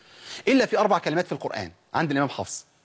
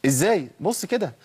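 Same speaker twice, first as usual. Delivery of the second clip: ordinary talk, nothing marked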